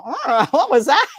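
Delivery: slow, loud, odd voice of the dog; little dog's voice. Pretend. Guest voices a lap dog startled by her bark. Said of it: "he goes".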